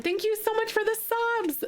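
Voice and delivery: high, overwhelmed thank-you; gushing thanks. Real, not acted. Streamer thanks viewers for gifted subs, high and gushing. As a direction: gush your thanks, voice way up.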